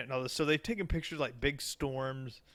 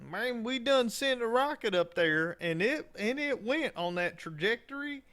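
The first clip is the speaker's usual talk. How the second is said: put-on Alabama accent; 5 semitones higher than usual. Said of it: "when they try to explain things".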